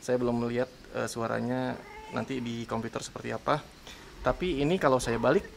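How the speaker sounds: ordinary talk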